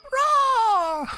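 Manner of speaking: high, loud squeaky roar; a tiny mouse trying to roar. Acted. Tiny mouse tries roaring.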